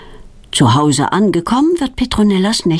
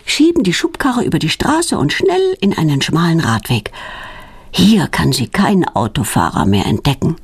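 ordinary delivery, nothing marked

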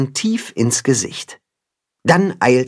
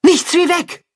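panicked. Acted. Fleeing wolves panic.

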